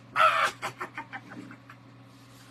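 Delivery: loud, breaking, voiceless cackle; cackling laugh. Retold or imitated. Cousin cracking up at the prank, laughing hard. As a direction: burst out in helpless staccato laughter. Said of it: "she was just like".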